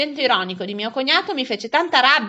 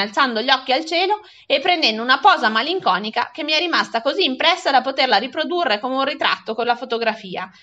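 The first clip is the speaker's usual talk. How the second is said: plain reading voice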